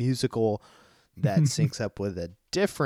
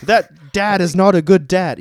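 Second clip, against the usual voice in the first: slow, loud, higher-pitched mock outrage; hammed-up indignant declaration; mock complaint; 7 semitones higher, 11 dB louder than usual. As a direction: parody a reviewer's blunt grievance, loudly. Mocks the reviewer listing flaws.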